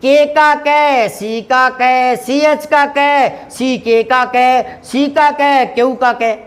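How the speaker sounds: teacher mocking English spelling, slow and drawn out; slow mocking listing. Shown as a demonstration. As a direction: drag out के का कै, सी का कै slowly. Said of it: "गलती तो वहाँ होगी".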